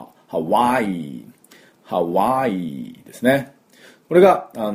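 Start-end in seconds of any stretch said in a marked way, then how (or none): 0.0-3.5 slow ハワイ with a throaty ハ; native ハワイ. Shown as a demonstration. Teacher modelling the native ハワイ, slow and throaty. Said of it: "正しいハワイの音".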